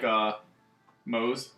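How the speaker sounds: normal talking voice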